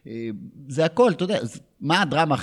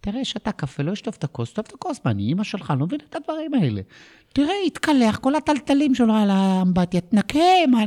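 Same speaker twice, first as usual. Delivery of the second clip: high, rising nagging wife voice, getting louder; 12 semitones higher, 4 dB louder than usual. Retold or imitated. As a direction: nag about the mess in a shrill high voice. Wife nagging alone at home. (אישה מתלוננת בקול גבוה ועולה)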